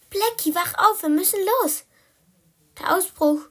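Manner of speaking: high, loud, excited rousing; urgent wake-up call. Acted. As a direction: rouse a sleeping friend for an escape. Waking friend for the escape.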